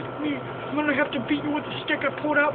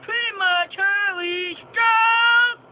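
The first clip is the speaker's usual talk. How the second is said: mock announcer voice; 4 semitones higher, 7 dB louder, much slower than usual. Mock command to friend.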